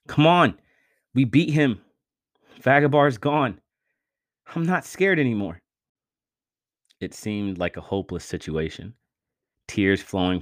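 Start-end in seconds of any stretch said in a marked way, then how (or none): 0.1-5.6 tearful pleading. A narrator's reading. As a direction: plead with him to come back. Vince pleading with Miko.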